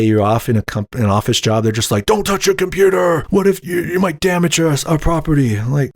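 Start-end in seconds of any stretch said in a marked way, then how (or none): 2.0-5.6 high-pitched mock shouting in a put-on voice; mock panicked bosses. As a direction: caricature bosses crying out in alarm. Mimics bosses forbidding computer use.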